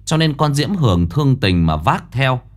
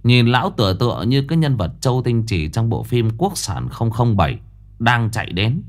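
ordinary delivery

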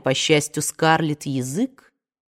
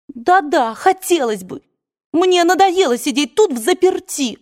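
higher, louder, petulant complaint; petulant outburst; 10 semitones higher, 3 dB louder than usual. A narrator's reading. Scarlett complains to aunt about being locked up, petulant. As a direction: stamp your foot in words.

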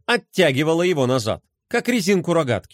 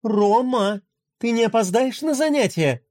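mother calling; 6 semitones higher than usual. A narrator's reading. Mother calls from elsewhere.

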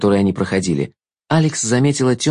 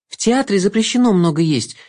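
put-on much higher chirpy girl voice; bright eager young girl; 9 semitones higher than usual. A narrator's reading. Agnesa speaks.

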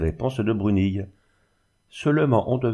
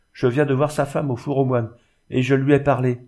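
higher-pitched, affronted insistence; offended insistence; 5 semitones higher, 3 dB louder than usual. A narrator's reading. Muleteer answering the daughter's denial, offended insistence. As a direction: insist firmly that you saw it yourself. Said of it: "blessé de ce démenti".

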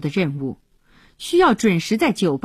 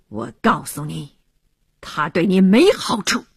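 cold sneer; 2 semitones lower than usual. Acted. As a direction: warn him coldly, with contempt. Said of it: "冷冷地笑道".

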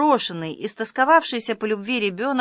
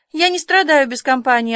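louder, higher, cutting reply; curt retort; 6 semitones higher, 6 dB louder than usual. A narrator's reading. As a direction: cut the suggestion off firmly and loudly. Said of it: "отрезала Марила".

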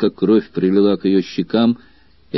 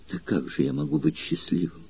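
usual speaking voice

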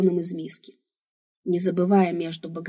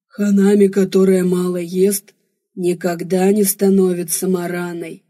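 drawling alien blackmailer; 6 dB louder than usual. A narrator's reading. Alien warrior blackmails her into eating, drawling loudly. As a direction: stretch it out as a coaxing threat. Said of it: "протянул инопланетный шантажист".

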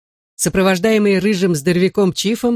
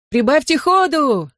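high-pitched urging call; 9 semitones higher than usual. A narrator's reading. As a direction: spur the runners on, pitch raised. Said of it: "крикнул Джонни".

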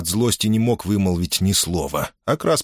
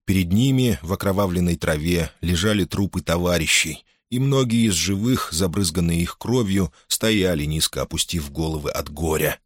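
ordinary delivery, nothing marked

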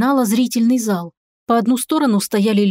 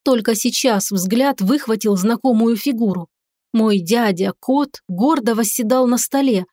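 ordinary delivery

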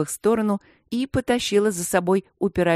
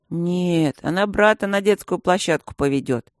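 ordinary delivery, nothing marked

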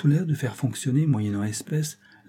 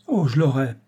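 bright exclamation, louder; exclaiming; 4 semitones higher, 5 dB louder than usual. A narrator's reading. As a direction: blurt it out with confident eagerness. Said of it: "s'écria-t-il".

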